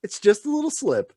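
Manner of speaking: high-pitched character voice; Tilly making excuses. Retold or imitated. Tilly after she murdered some people, high-voiced excuse-making. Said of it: "and she's like".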